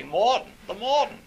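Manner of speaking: "mawd'n, the mawd'n", high and loud mock New England accent. Graduate students copying their professor, high loud New England accent. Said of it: "Back Bay in Massachusetts say".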